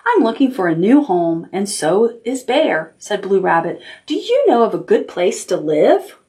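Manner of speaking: gentle storybook reading; reading to children. Acted. Teacher reading a picture book to the class, storytelling. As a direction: tell it like bedtime story time.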